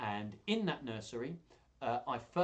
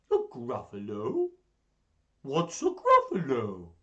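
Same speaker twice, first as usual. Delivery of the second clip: louder, slow owl question; puzzled owl; 11 dB louder, slower than usual. Acted. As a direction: ask it baffled. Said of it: "do all the voices".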